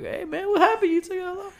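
very high, laughing mock concern. Nephew asking uncle what happened, squeaky laughing. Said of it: "I'm like".

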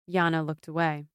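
ordinary delivery, nothing marked

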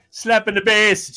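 high, loud, drawn-out mock Jamaican accent; badly faked Jamaican movie-quote voice. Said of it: "that's the "I love you, man" joke".

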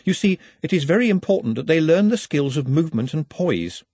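earnest, careful justification; a touch of nerves; nervously. A narrator's reading. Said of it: "said Nutt nervously".

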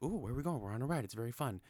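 high, eager puppy voice, bouncing up; excited puppy. Retold or imitated. Pup on a car ride asks his owner where they're going, excited. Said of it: "Todd is all excited".

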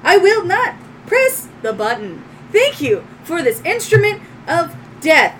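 high, loud robot solemnity; robotic droid. Acted. Droid thanking owner for a gift, high robotic voice. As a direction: pledge it formally as a machine. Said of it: "And it goes".

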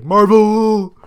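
high, loud, drawn-out squeal of fandom; mock fan cheer. Podcaster mimicking the majority of his schoolmates, mock fan cheer. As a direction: imitate an overexcited fan cheering.

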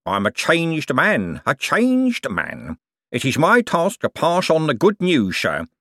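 sanctimonious con man. Acted. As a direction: preach your false repentance with whistling sibilants. Cribbins feigns repentance.

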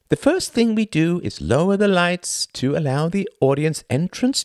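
high, showy stage-host voice; theatrical thin man. Acted. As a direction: play the pompous thin performer lecturing the audience. Thin man hosts the play.

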